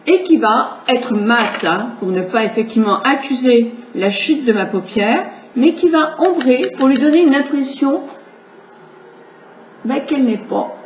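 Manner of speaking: usual speaking voice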